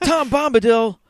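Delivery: high, loud fanboy exclamation; excited outburst. Real, not acted. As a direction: blurt the name out with glee. Fan outburst about Lord of the Rings.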